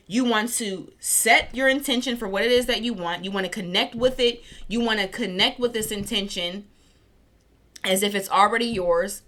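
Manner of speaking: usual speaking voice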